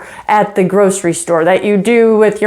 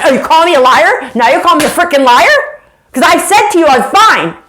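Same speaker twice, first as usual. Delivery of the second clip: raised, loud, indignant acting; defensive outburst; 7 semitones higher, 4 dB louder, faster than usual. Spouse accused of lying argues back, loud and indignant. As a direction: fire back as if wrongly accused.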